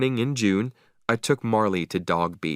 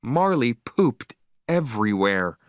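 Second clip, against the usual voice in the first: ordinary delivery, nothing marked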